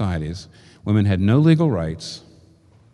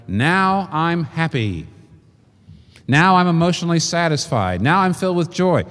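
mock gushing; 6 semitones higher than usual. Mock quote of the widow.

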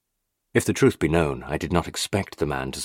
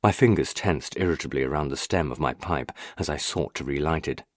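ordinary delivery

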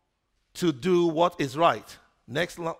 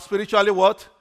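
Spiritually what raised, louder for the congregation; 4 semitones higher, 7 dB louder, faster than usual. Shown as a demonstration. Pastor calls the congregation to repeat it, loudly. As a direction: say it out loud so the whole room hears. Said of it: "Can you say that loud?".

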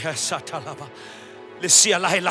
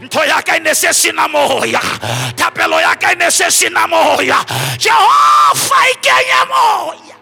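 rising to a full-voiced shout; preaching shout; 6 semitones higher, 6 dB louder than usual. Real, not acted. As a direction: preach it louder and higher.

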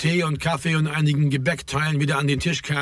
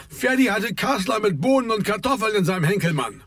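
higher-pitched call across the room; lightly projected teasing announcement; calling over; 7 semitones higher than usual. A narrator's reading. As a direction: spread the news across the bakery, pitched up. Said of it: "rief Raimund herüber".

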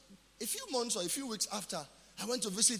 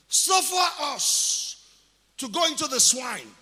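loud, very slow, drawn-out plea; demons pleading; 4 semitones higher, 8 dB louder, slower than usual. Acted. Demons begging Jesus to spare them, loud slow plea. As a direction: beg like a cornered demon.

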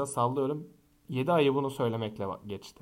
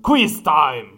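loud game show call; announcer shout; 11 dB louder than usual. Acted. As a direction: announce it like a quiz host. Announcing quiz.

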